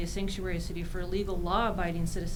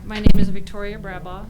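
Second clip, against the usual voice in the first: speaking up for the microphone; louder; 15 dB louder than usual. Real, not acted. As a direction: project it clearly into the mic. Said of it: "Can you speak closer to the microphone?".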